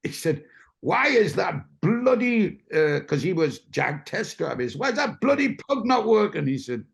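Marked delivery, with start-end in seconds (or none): 0.8-2.6 slow, high, indignant demand; angry boss. Retold or imitated. Lyons berating his test driver, slow and indignant. 4.8-6.5 high, indignant demand; angry boss. Retold or imitated. Lyons berating his test driver, high and indignant.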